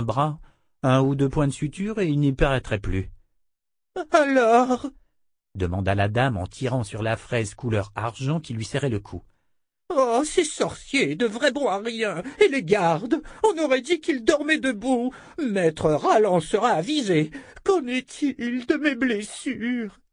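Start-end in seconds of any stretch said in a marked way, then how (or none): 4.1-4.9 whiny voice. Acted. Noblewoman demands. 9.9-20.0 whiny voice. Acted. Noblewoman rants.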